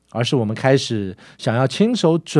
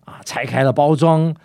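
ordinary talk, nothing marked

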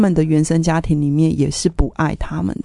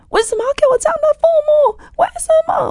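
very high, fast frantic shriek; near-hysterical screamed question; screaming; much faster than usual. Retold or imitated. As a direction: shriek it down the phone. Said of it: "跟尖叫的时候".

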